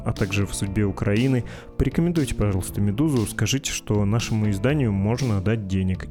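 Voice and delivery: usual speaking voice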